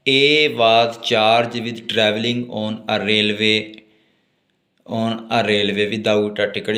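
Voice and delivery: reads the statute slowly, word by word; slow dictation. Acted. Teacher slowly dictating law text to note-taking students. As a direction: dictate it so students can write.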